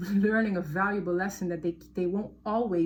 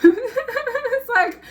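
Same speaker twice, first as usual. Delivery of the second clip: real laugh; 13 semitones higher, 10 dB louder than usual. Real, not acted. Surprised at the cards.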